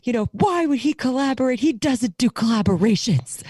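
high-pitched imitation of an offended fan; mock indignant complaint; retold indignation. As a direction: act out his outraged objection, pitched well up. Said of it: "I think he was like".

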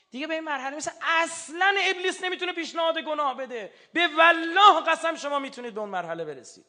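loud, high-pitched, impassioned sermon; full-force preaching. Real, not acted. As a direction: proclaim it to the whole congregation with total conviction.

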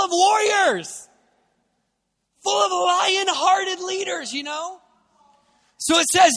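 rally them like warriors; fiery preaching. Acted. Preacher rallying the UK church to confidence, fiery preaching.

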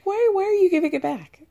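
can't believe what he just said; incredulous. Real, not acted. Reacting to Mike's no, high disbelief.

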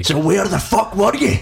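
put-on Glaswegian accent. Quoting the Scottish-sounding cop, Glaswegian accent. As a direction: snap it in a thick Glaswegian accent. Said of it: "Glaswegian character quote".

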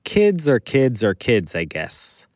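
usual speaking voice

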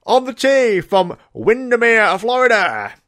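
high, loud, drawn-out golf announcing; tournament announcer. Acted. Announcer introducing Jamie at the Masters, booming slowly and high. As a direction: belt out the player's introduction slowly. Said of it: "All crowd going".

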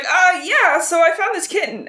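high, loud, nervous fib; sheepish lying cat. Retold or imitated. Fireheart caught out by the clan, high and nervous. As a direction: bluff through it with an awkward laugh in the voice. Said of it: "And he's like".

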